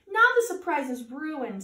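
plain reading voice

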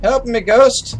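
high, loud call for help. Real, not acted. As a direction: yell to the ghost across the room. Calling to a ghost.

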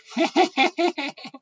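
very high maniacal cackle; wild mad laugh. Acted. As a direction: cackle crazily in a high pitch. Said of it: "cười mang dại". (tiếng cười man dại, the thé)

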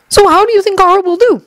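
very high-pitched, light questioning voice; Minato. A narrator's reading. Minato asking the Kazekage about Gaara, high voice.

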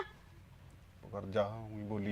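hushed, urgent whisper; shushing his wife in a whisper as his brother walks in. Acted. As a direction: hiss it low and slow so the brother won't hear. Husband hushes wife, brother coming.

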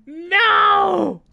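a high, loud, drawn-out cry of despair; anguished shout. Real, not acted. Streamer seeing the lost series, real anguished shout. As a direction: wail it at the result you can't undo.

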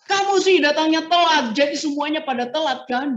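high, loud mock scolding; acted angry scolding. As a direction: scold a latecomer, pitch up and loud. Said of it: "Marah tinggi banget nada bicaranya". (memarahi teman yang telat dengan suara tinggi)

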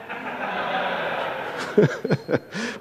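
breathy laugh; soft wheezy laugh; airy laugh. Real, not acted. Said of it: "laughter at excuse question".

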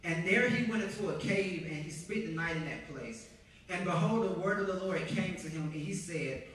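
firm, full-voiced Bible reading; scripture reading. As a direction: read the passage out with weight. Reads scripture aloud to the congregation.

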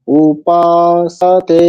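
উপাসাতে drawn out slowly, পা and তে held long; long syllables. Shown as a demonstration. Teacher modelling long vowels, উপাসাতে slow with পা and তে sustained. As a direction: stretch পা and তে in উপাসাতে. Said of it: "পা দীর্ঘ হবে আর তে দীর্ঘ হবে".

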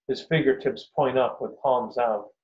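ordinary delivery, nothing marked

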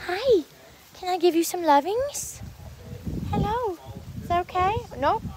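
high-pitched coaxing pet talk; cooing to a cat. Real, not acted. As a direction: ask a shy kitty sweetly. Asking a cat to allow petting.

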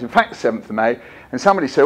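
ordinary delivery

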